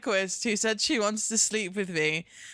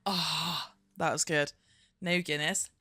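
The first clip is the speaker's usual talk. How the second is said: usual speaking voice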